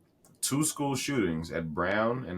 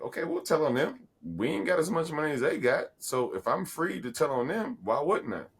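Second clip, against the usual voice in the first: lightly acted snitches, pitched up; 7 semitones higher than usual. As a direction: make the case for telling, in a higher voice. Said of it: "that were lower level, they were like".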